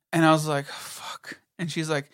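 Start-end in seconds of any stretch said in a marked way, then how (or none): 0.7-1.4 hushed, whispered curse of resignation; muttered dismay. Retold or imitated. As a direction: act out a deflated curse under the breath. Retold dismayed reaction to bad news.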